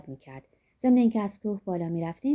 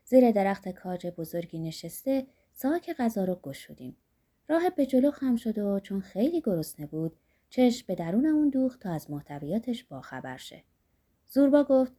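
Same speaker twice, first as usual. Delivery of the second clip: ordinary delivery, nothing marked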